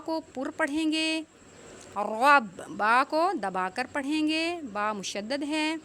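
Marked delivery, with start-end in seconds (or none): none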